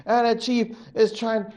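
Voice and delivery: ordinary delivery, nothing marked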